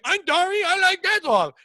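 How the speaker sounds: high, loud, slurred put-on voice; exaggerated high garbled character voice; mock impaired-speech voice. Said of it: "I went into full tard mode".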